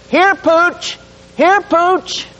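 high sing-out dog call; high-pitched "here, boy" style call. Pretend. Acts out calling the dog.

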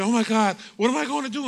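a panicked woman who lost her rent; mock frantic scream. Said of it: "she was screaming".

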